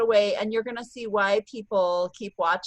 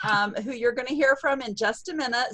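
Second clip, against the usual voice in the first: ordinary delivery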